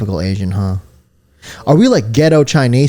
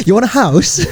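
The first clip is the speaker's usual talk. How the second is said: higher, mock British salesman pitch; British estate agent; 9 semitones higher, 3 dB louder than usual. Imagining friend as British real estate agent, mock British accent. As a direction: sell him a house in a put-on British accent. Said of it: "Imagine Kyle as a British real estate agent".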